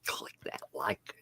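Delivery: whispered aside. Real, not acted. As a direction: breathe it in a whisper. Asks viewers to like video.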